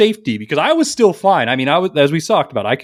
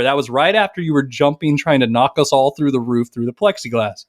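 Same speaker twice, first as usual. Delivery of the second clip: ordinary talk, nothing marked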